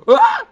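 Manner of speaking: gasp, then high loud shriek; short piercing yelp of fright; startled scream. Real, not acted. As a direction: scream as if jumped. Startled while playing horror game.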